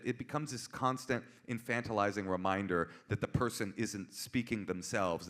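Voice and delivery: usual speaking voice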